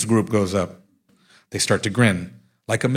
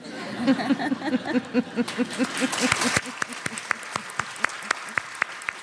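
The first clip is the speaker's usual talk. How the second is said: a long, high, hearty laugh; 14 semitones higher, 6 dB quieter than usual. Real, not acted. After teasing Kingston about his shorts, a long hearty laugh.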